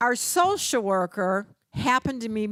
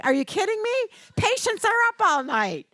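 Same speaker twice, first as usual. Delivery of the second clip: much higher, indignant disbelief; incredulous retort; 12 semitones higher, 3 dB louder, faster than usual. Retold or imitated. Wife answering husband about night shift, indignant disbelief. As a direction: shoot back in disbelief at the husband. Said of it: "And I'm kind of like".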